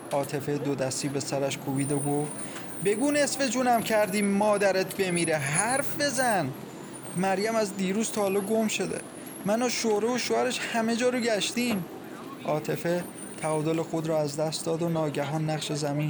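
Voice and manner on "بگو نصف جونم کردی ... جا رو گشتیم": high, agitated dramatic reading; distraught. A narrator's reading. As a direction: act out a frantic family exchange. Mother demands, daughter confesses in distress.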